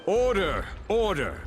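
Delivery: loud, high gavel-bang commands; calling for order. Acted. Judge silencing the murmuring courtroom, loud stern call. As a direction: cut through the crowd's noise.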